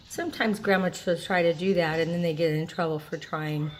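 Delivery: ordinary talk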